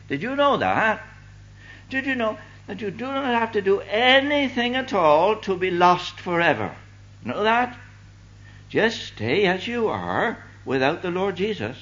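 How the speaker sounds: high, loud, insistent challenge; urgent preaching. Acted. Preacher warning unbelievers they are lost, urgently proclaimed. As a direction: press the warning home with soaring pitch.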